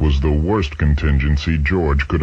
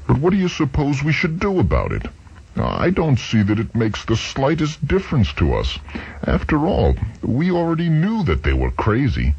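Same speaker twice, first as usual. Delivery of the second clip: higher, faster dismissive voice; dismissive George; 9 semitones higher than usual. Acted. George brushing off Chuck's fears, higher quick dismissal. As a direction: wave the worry away, quickly.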